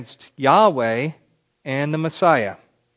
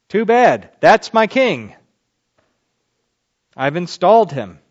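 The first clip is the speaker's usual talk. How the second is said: God proclaiming; 3 semitones higher, 7 dB louder than usual. Acted. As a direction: thunder it out as God crowning his king. God declares his king.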